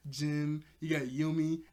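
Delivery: normal talking voice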